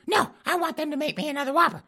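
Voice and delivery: put-on high squeaky old lady griping; falsetto wife complaining. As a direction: whine it in a granny falsetto. Wife voiced demanding.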